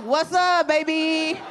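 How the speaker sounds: loud fake-charming pickup line. Crack pipe man hits on her.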